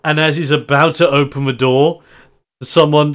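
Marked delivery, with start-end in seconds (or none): none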